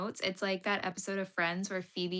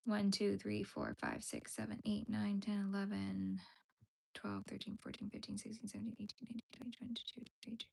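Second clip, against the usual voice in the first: quiet counting; 10 dB quieter than usual. Shown as a demonstration. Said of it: "Like that?".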